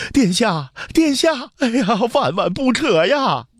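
very high, wailing plea; desperate calling after. Acted. Steward chases the departing prince, calling out desperately. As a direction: cry out frantically to stop him. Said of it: "追着刘彻的脚步喊着".

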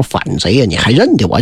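low-pitched taunt from a foe; lightly voiced villain. Villain taunts his challenger in battle.

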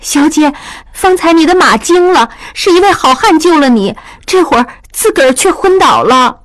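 put-on high-pitched excited young maid; maid, agitated. A narrator's reading. Maid telling her mistress about the rescue, agitated. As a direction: explain it anxiously in a high voice.

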